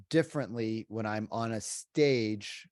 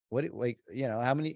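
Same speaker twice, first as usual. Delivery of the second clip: ordinary talk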